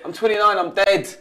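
high, loud mock despair; mock dramatic moan. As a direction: ham up the whining about age, pitched high. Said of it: "And then they get".

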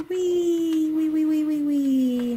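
loud, high wee; loud, happy wheeing. Acted. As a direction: squeal with carousel delight. Toys ride carousel.

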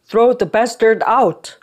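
barked order. A narrator's reading. Young master orders the mute hauled out, barking loudly. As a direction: order the servants sharply and forcefully. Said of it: "gave an order".

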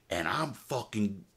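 ordinary talk

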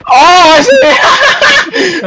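high, bursting laugh through the words; real laughing surprise; laughing realization. Real, not acted. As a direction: laugh as it clicks. Spots teammate's hidden sniping spot.

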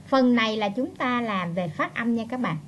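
usual speaking voice

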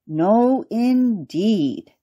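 plain reading voice

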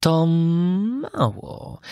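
very drawn-out feline tone; meowing cat. Acted. Cat tempting puppet, slow meowing voice. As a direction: stretch it slyly like a cat.

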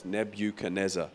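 normal talking voice

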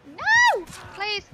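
very high, very loud pleading scream; panicked scream. Real, not acted. Streamer screaming as his character dies, panicked high pleading. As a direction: scream as if it's all slipping away. Dying in game.

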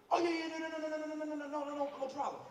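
a nervous barber brushing off worry; twitchy Gloria. Retold or imitated. Gloria reassuring the customer, twitchy and rushed.

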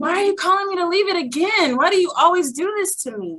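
high, pleading mock whine; struggling complaint. Disciple asking God why she must leave, high-pitched complaint. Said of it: "I was struggling".

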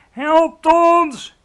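very loud, high cry for help; wild screech. A narrator's reading. Giant thrashing wildly in his nightmare, high screeching shout. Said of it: "krijste de vleeslapeter woest".

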